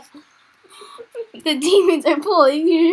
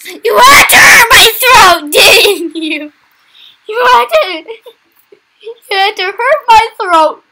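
higher, louder accusing shout; mock-angry complaint; 4 semitones higher, 7 dB louder than usual. Real, not acted. Blaming the game for the hurt throat, mock-angry shouting.

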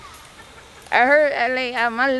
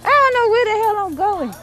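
high-pitched, exasperated wail; lost wail; 10 semitones higher, 4 dB louder than usual. Real, not acted. Lost, wails it first time.

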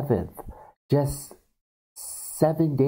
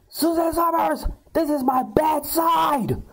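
high hammed-up complaint, last words stretched; Beverly's shrill protest. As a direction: imitate an indignant woman in a high squeal.

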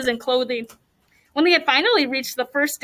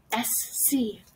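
hushed, much softer voice; whispered; 2 semitones lower, 10 dB quieter than usual. A narrator's reading. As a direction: breathe the initials under your breath. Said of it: "Dominic whispered the initials".

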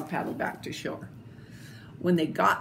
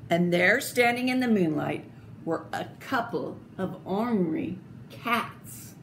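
plain reading voice

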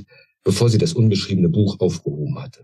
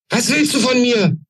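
the queen shrieks her question at the intruder; shouting. A narrator's reading. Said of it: "schrie sie die Alte an".

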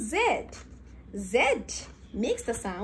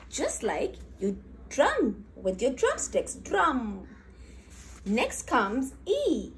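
ordinary talk, nothing marked

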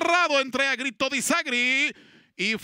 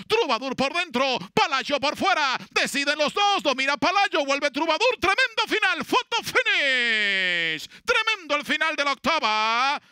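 excited finish call; 3 semitones higher than usual. Real, not acted. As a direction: call the tight finish, rising. Photo finish climax.

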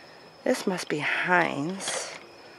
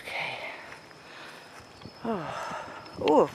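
usual speaking voice

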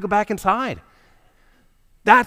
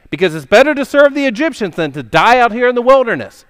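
louder, higher accusing complaint; furious panic; 4 semitones higher, 8 dB louder than usual. Acted. Israelites accusing Moses, louder and higher in panic. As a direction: hurl the blame at Moses. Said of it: "they're furious and they're panicked".

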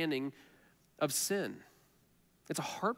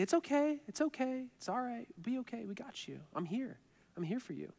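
high, soft comforting voice; tender reassurance; 7 semitones higher, 6 dB quieter than usual. Acted. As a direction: soothe a hurt child, high and softly. Comforting a hurting child.

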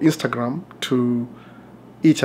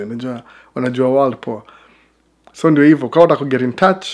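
ordinary delivery

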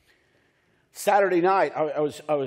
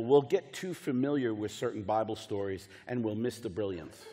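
usual speaking voice

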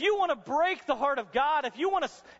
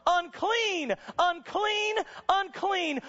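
raised, ringing mock cry of a leper; acted leper's shout; 7 semitones higher, much slower than usual. Leper crossing the crowd toward Jesus, a mock cry. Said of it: "shouting from the top of his lungs".

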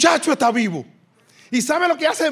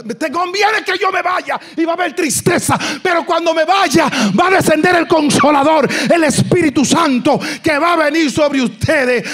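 louder, higher, building preaching shout; fiery preaching; 3 semitones higher, 5 dB louder than usual. Acted. Preacher proclaims Jesus's promise of the Spirit, shouting louder. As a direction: proclaim the Comforter's coming with full force.

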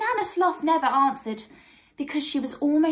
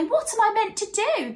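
high, plaintive question; crying out in despair; 8 semitones higher than usual. A narrator's reading. Nana Sloth asks her friend Herbie for help, despairing cry. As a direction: plead helplessly, pitched up. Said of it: "cried Nana Sloth".

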